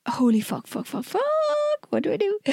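retold panic, rising high and hushed; mock panic. Retelling to cohost her reaction as he pulled up, mock panic. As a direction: swear under mounting panic, lightly acted.

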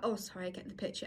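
quick, low-key mumbled apology; embarrassed retreat. Retold or imitated. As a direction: back off softly and hurriedly. Rejected man backing off.

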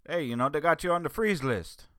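put-on quoting the Jamaican shift commander in a light Jamaican accent. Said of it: "he's Jamaican, so he's like".

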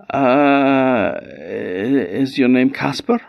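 high, halting, questioning voice; stall, then venture a tentative guess; hesitant guessing. A narrator's reading. Queen guesses the little man's name, hesitant.

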